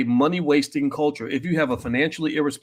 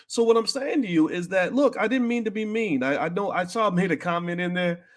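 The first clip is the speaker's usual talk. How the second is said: usual speaking voice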